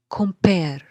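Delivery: "Compare" loud, with the weight on "pare"; stress on second syllable. Shown as a demonstration. Teacher splitting the word into syllables, loud stress on "pare". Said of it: "the second one is stressed".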